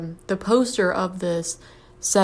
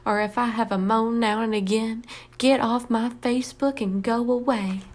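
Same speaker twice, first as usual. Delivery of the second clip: put-on woeful Southern accent. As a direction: read it as a self-pitying Southerner. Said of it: "It's like a woe is me voice".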